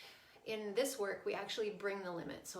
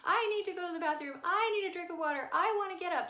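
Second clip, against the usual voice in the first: loud, high mock wail; crying child; 9 semitones higher, 7 dB louder than usual. Daughter in tantrum begs mom to stay up, mock crying. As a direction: imitate a child whining through tears. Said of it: "she was crying the whole time".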